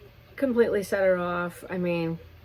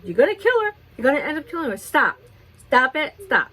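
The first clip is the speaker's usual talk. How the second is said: much higher, louder alarmed pleading; urgent retold shout; 6 semitones higher, 3 dB louder, faster than usual. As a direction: yell at her to stop before the dog gets hurt. Begging neighbor to stop hurting dog.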